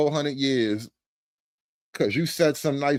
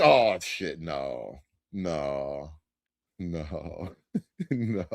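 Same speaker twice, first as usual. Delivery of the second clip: low, soft, drawn-back no; hushed, low disbelief; dismissive refusal; 13 semitones lower, 6 dB quieter, much slower than usual. Acted. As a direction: drop right down and refuse under the breath. Amused refusal after disbelief.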